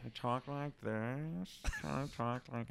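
slow, soft imitation of a bad voice; mock her awful voice, drawing the words out; put-on bad voice, slow and quiet; bad voice impression. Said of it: "she had a really bad voice".

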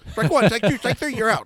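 loud, high cartoon umpire call; umpire calling strikes. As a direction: bellow it like a Bugs Bunny umpire. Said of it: "it'd be like the Bugs Bunny".

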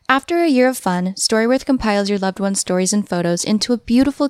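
ad read. Acted. Host reads a sponsor ad.

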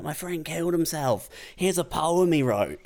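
high, earnest announcement; retold crazy open micer. Imitating the open micer reading a dead friend's poem. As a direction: announce it earnestly like it's normal. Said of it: "being like".

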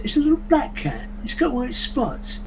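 high-pitched English dialect; retelling it in an English accent. Acted. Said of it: "if I can do an English dialect".

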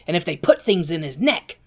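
ordinary talk

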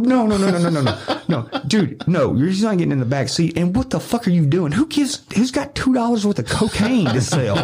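high-pitched incredulous protest, laughing; retold outraged sputter breaking into laughter; flustered refusal. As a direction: rattle off high, disbelieving objections.

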